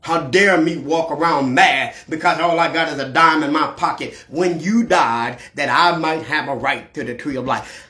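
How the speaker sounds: ordinary talk, nothing marked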